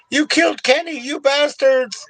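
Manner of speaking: very high mock outrage, stretched ending; cartoon outcry. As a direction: squeal it like an outraged cartoon kid.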